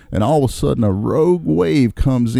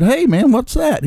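high mock voice; 13 semitones higher than usual. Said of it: "He said".

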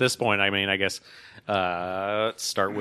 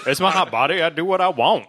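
louder, high-pitched mock-sassy retort; playful defiance; 8 semitones higher, 7 dB louder than usual. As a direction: throw it back with mock attitude. Playful retort to being scolded.

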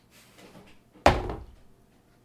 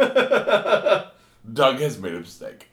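ominous narrator; 2 semitones higher, 7 dB louder, much slower than usual. Pretend. Slow ominous announcement.

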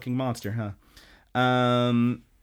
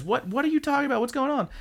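much higher, puzzled character voice; high confused question; confused Retsuko; 14 semitones higher, faster than usual. Retold or imitated. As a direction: ask it in a high, bewildered cartoon voice. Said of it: "And she says".

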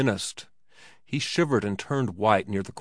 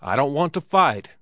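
raised, higher protesting shout; 4 semitones higher, 6 dB louder than usual. A narrator's reading. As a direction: shout it out in alarm. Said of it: "he shouted".

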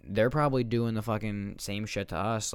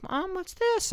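put-on much higher small-kid voice; child asking mom; 4 dB louder, slower than usual. Kid finding mom's whip, high childlike voice. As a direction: play an innocent little kid. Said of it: "and like".

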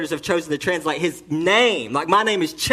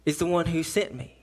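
normal talking voice

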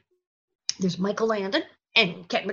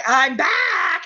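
higher, drawn-out triumphant announcement; mock comeback cry; 6 semitones higher, 4 dB louder than usual. Actor joking about her endless comebacks, mock-triumphant. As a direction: announce a return with mock fanfare.

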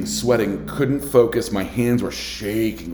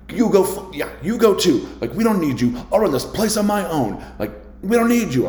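screaming manager; 9 semitones higher than usual. Retold or imitated. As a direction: yell them out the door in a rage. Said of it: "proceeded to scream at me".

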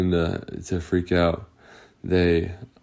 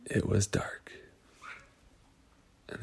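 whispered, hushed narration; spooky whisper; 14 dB quieter than usual. A narrator's reading. Storyteller whispers to the children, hushed. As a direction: breathe the words like a secret.

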